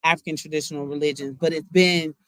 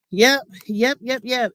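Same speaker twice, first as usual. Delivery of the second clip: higher, louder quick yep yep; emphatic yeps; 7 semitones higher, 4 dB louder than usual. Real, not acted. Vlogger hyping her own point to viewers, eager yeps.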